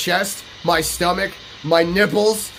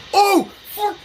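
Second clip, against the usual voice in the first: real excited shout; 12 semitones higher, much slower than usual. Real, not acted. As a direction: yell with genuine thrill at the payout. Sees huge in-game haul.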